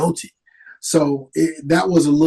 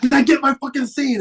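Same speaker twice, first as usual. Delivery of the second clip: angry director; 10 semitones higher than usual. Retold or imitated. Director after slap.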